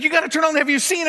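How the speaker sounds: high, loud retold panic; frantic caller yelling down the phone; mock frantic shout. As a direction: play the screaming hotel caller, frantic. Screaming lady insists.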